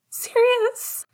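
put-on very high child's squeal; shrieked. A narrator's reading. Little brother spots Sirius on screen, high-pitched shriek. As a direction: squeal the name like a thrilled kid. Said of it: "Julian shrieked".

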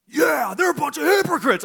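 high, loud mock-angry shout; angry outburst. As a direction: bellow it as an imitation of an indignant heckler. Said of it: "he's mad again".

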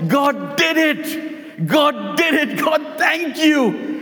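high, slow cries of praise, retold; joyful screaming. Old lady screaming praise again at the neighbor, high and drawn out. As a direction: shout thanks up at a high pitch. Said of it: "started screaming again at the top of her voice".

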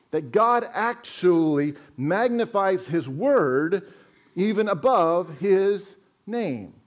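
slow, weight on above; slow emphasis. Shown as a demonstration. Pastor points congregation to verse, slow and emphatic. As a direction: draw out even above His name. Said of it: "Look what it says".

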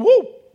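a yelped whoop like something snapping back; whooping sound effect. Acted. Preacher acting out God's quick fix, whooping loud.

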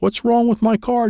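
high-pitched cry without much volume; mild scream. Acted. Dad as his car brakes by itself, screaming. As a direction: complain in a high, strained yelp. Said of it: "Dad screamed".

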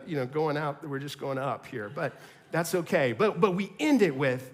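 usual speaking voice

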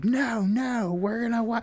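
high-pitched imitation of a protesting girlfriend; mock petulant refusal. Retold girlfriend whining refusal.

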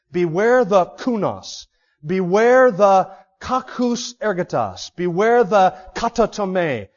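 slow, with hard k sounds in "kunos", "kakus", "katatome"; alliterated Greek warning. Shown as a demonstration. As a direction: lean on each k, slowly. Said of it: "all start with a k".

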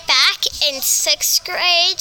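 plain reading voice

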